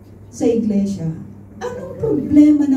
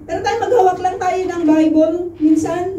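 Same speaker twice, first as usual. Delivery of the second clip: ordinary talk, nothing marked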